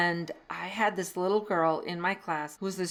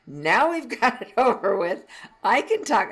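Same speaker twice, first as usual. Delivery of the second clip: laughing relief; 4 semitones higher, 6 dB louder than usual. Retold or imitated. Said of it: "she said".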